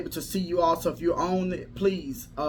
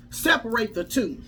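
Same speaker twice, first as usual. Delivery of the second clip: ordinary talk, nothing marked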